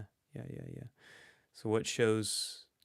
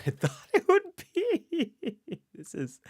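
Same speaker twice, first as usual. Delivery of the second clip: laughing; 7 dB louder than usual. Real, not acted. Laughing at own struggle guessing.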